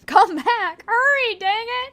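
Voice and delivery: high, loud urgent call; shouting. Acted. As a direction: yell for her to hurry. Calling to Wendy.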